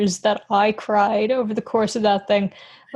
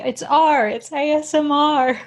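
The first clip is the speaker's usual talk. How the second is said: higher-pitched mock outcry of correction; half-shouted, hammed-up protest; mock screaming; 6 semitones higher, slower than usual. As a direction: imitate indignant listeners yelling a correction. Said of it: "People are screaming at me".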